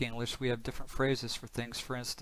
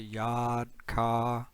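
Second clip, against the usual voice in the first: R-less Boston "yard, car"; Boston accent; 3 dB louder than usual. Retold or imitated. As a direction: imitate a Boston accent, dropping the R's. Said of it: "you'll hear some of this in movies".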